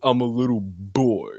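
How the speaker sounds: Kratos. Retold or imitated. Friend mimicking the player's delivery, deep Kratos voice. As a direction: drop it deep like a game hero. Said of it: "Not, not go Kratos like".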